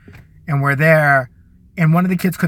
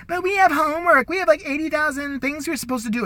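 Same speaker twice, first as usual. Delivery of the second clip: high whiny tattletale voice; mocking tattletale; 10 semitones higher than usual. Mocking the kid who reminds the teacher of homework, high whine. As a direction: whine it like the class snitch. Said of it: "one of those assholes".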